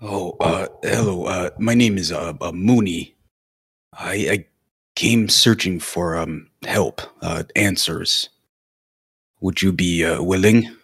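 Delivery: a timid, halting Mooney; hesitant in character. Acted. Mooney nervously asks the druid for help, halting and timid. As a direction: stumble through the greeting shyly.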